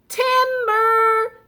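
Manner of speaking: high, loud call of timber; shouting timber. A narrator's reading. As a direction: yell it like a falling tree. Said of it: "shouted Max".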